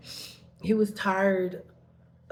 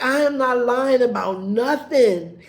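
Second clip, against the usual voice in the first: angry retort; 4 semitones higher, 8 dB louder than usual. Retold or imitated. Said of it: "'cause I'm like".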